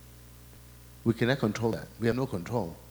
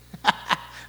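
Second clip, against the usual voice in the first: real laugh. Real, not acted. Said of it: "I'm laughing 'cause he's making me laugh".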